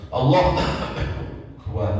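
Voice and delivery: Allah cut by a short cough, then Akbar; cough break. Shown as a demonstration. Teacher shows a takbir broken by a cough. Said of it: "karena batuk misalnya".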